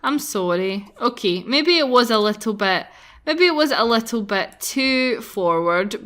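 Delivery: ordinary delivery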